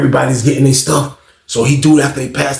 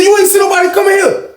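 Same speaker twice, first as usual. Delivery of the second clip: high-pitched heated interrogation; accusing outburst; 3 dB louder than usual. Retold or imitated. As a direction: confront the roommate. Victim confronts his roommate.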